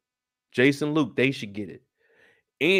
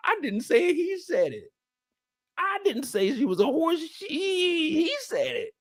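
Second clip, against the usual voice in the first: mock defensive; 12 semitones higher, 4 dB quieter than usual.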